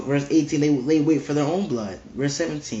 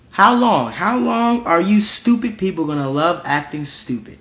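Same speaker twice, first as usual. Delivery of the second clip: fiery rebuke; 4 semitones higher than usual. Acted. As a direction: demand it of the fools, raising your voice. Rebuking the foolish, calling out.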